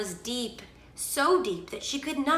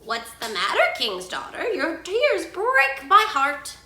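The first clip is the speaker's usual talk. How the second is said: higher, drawn-out frog voice; calling frog; 8 semitones higher, 6 dB louder than usual. A narrator's reading. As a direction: call out slowly with concern. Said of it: "she heard a voice calling".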